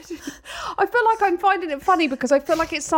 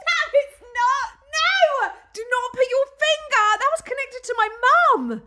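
squealing; 9 semitones higher than usual. Real, not acted. Squealing refusal.

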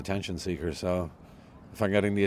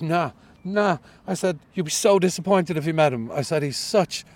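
higher, louder mock rant; 9 semitones higher, 6 dB louder than usual. Slagging himself off to the driver, louder ranting. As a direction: wind up into a gleeful rant. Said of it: "and I went on a rant".

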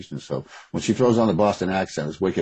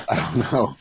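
laughing, slow and drawn out; 6 semitones higher, slower than usual. Real, not acted. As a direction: let the words dissolve into a laugh. Repeats it with laughter.

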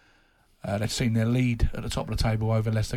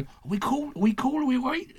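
anxious manager; 12 semitones higher than usual. Retold or imitated. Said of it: "he always says to me".

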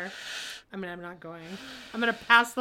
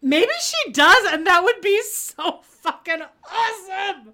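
excited; 7 semitones higher, 6 dB louder than usual. Real, not acted. Gleeful hope about boyfriend.

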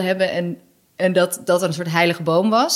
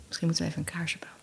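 zacht samenzweerderig gefluisterd; fluisterend; 3 semitones lower, 13 dB quieter, much faster than usual. Pretend. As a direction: fluister het als een spookverhaal. Joke about exorcism, candle. (spottend fluisteren)

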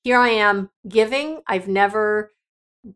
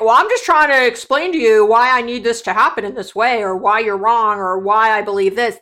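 loud, insistent self-justification; angry pushback; 6 dB louder than usual. Acted. Someone asked about feelings pushes back, mock angry and defensive. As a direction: push back loudly, acting out someone on the defensive. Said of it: "They will get even angry at you".

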